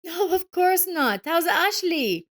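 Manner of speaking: high, loud, condescending voice; gloating ex-wife. A narrator's reading. Ex-wife mocking Joseph about her husband's promotion, gloating. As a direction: rub it in that he looks like a bum. Evelyn asks, drawn out.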